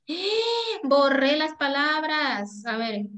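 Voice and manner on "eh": sudden high startled eh; startled exclamation. Real, not acted. Teacher noticing she erased the words, startled. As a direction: catch yourself in surprise.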